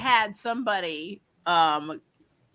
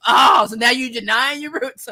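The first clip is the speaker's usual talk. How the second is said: raised, louder mock accusation; riled up; 4 semitones higher, 6 dB louder than usual. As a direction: imitate an offended stranger calling you out. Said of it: "that just really riled them up".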